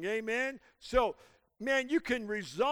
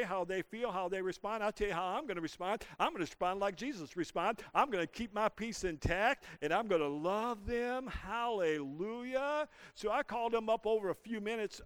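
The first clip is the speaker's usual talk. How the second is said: usual speaking voice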